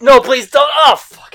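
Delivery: loud, high mock panic; alarmed plea. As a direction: act out a desperate plea as disaster hits. Voicing Clark Kent seeing disaster coming.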